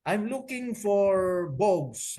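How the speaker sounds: slow and high, voicing the pastor on the line; caller on the phone. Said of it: "sabi niya".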